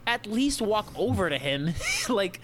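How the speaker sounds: high, drawn-out mock pleading; exasperated plea. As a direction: beg in hammed-up exasperation, stretching the words. Acted exasperated plea to passive teacher.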